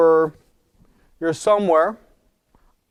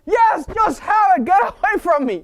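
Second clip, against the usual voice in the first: exasperated shout; 13 semitones higher than usual. Retold or imitated. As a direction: snap at the kid to go away.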